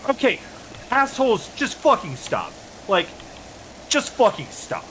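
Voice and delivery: high-pitched exasperated plea to stop. Retold or imitated. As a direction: throw up your hands at the other players. Retelling his own exasperation.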